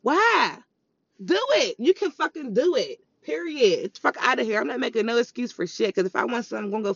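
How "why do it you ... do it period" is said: fired-up push. Real, not acted. Pushing listeners to act.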